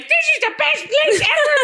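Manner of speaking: overjoyed. Retold or imitated. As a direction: gush like the dive bar is paradise. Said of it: "I'd be like".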